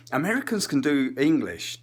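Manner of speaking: ordinary delivery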